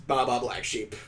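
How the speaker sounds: ordinary delivery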